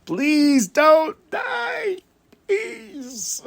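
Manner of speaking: very high, very slow strained cry of please don't die; a fan's anguished high wail; desperate plea. Retold or imitated. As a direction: plead harder, almost shouting. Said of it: "moments where I'm like".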